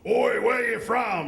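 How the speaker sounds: loud, drawn-out Australian challenge; heckling Australian local; Australian accent. Retold or imitated. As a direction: call it out slowly in an Australian accent. Rough local challenges a tourist.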